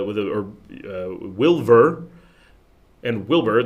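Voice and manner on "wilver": "Wilver" with a V in the middle; slow, louder "Wilver", V replacing the B. Shown as a demonstration. Showing viewers how Wilbur gets pronounced "Wilver", V for B. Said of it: "is pronounced Wilver".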